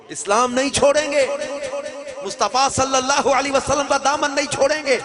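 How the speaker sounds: proclaimed loud and high, full conviction; defiant vow. Acted. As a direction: ring it out like a vow. Brothers' defiant vow in sermon. (پرجوش بلند اعلان)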